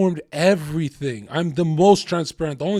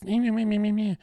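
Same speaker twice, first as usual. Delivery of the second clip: put-on higher, childish nagging meh sounds; taunting meh meh meh; mocking bleat; 5 semitones higher than usual. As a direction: parody petty bickering. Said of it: "stoop down to that level of like".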